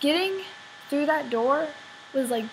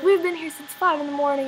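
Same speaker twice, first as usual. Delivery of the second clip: whiny indignant mom; 6 semitones higher, 5 dB louder than usual. Retold or imitated. Mimicking the annoyed mom.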